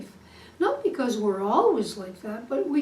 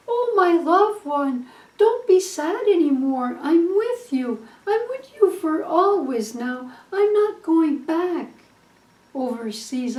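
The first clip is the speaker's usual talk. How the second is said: higher, louder, tender reassurance; tender husband; 4 semitones higher, 5 dB louder than usual. Acted. Husband home from war comforts his sad wife, tender and raised. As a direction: soothe her like a loving husband come home.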